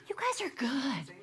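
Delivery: gasp, then an awed whisper; breath-caught, whispered playful praise; mock amazement. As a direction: whisper it as if astonished. Gasps at audience's correct answer.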